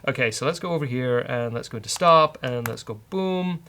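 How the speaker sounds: ordinary talk, nothing marked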